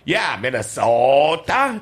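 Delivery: slow, drawn-out Minnesota vowels; exaggerated Minnesota accent. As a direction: overdo the Fargo Minnesota sound. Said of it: "That's, that's not how we talk".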